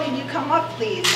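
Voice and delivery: ordinary talk, nothing marked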